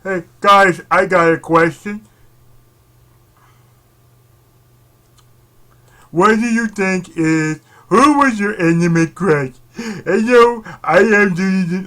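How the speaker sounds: loud, pushy mock poster voice; mock eager poster. Vlogger imitating anime fans posting on Facebook, loudly. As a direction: ham up an attention-seeking post. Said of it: "and I'm like".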